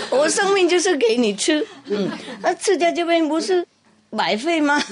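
high, laughing mouse voice; mouse pleading. Acted. Mouse bargains with the cat, high and laughing. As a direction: squeak out the bargain, amused.

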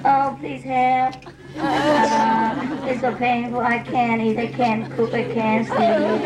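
put-on slow, whining self-pity like a sick baby; whiny sick baby. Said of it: "I'm more like the baby".

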